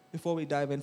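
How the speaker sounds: ordinary delivery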